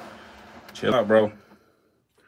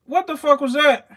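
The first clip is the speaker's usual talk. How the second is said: jumps much higher and louder at the strike; startled; 6 dB louder than usual. Real, not acted. Keeper startled when the snake strikes, high loud blurt. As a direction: caught off guard, blurting it out.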